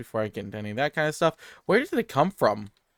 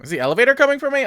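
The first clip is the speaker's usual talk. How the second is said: alarmed; 12 semitones higher, 6 dB louder, faster than usual. Real, not acted. Elevator startles player.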